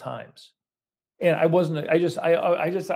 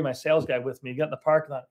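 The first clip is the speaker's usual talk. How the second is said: ordinary talk, nothing marked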